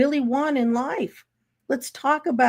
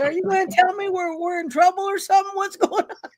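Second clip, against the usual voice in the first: worried husband; 7 semitones higher than usual. Retold or imitated. Husband worried they're in trouble.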